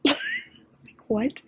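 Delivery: very high, rising disbelief; stunned high-pitched what; incredulous squeak. Real, not acted. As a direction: squeak out a baffled question. Reacting to parenting thought.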